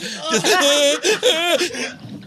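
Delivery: very high gasping sob, half laughing; gasp cry. Retold or imitated. Boy sobbing in the back seat, high gasping cry. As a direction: imitate a kid's gasping cry. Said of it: "The fucking gasp cry".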